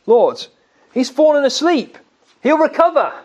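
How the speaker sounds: disciples' reassurance. Retold or imitated. Preacher voicing disciples to Jesus, hopeful.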